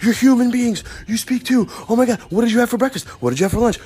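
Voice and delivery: high, gushing greeting; hammed-up starved-for-company excitement; overexcited. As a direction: gush at people like you haven't seen humans in forever. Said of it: "I'm, like, all excited".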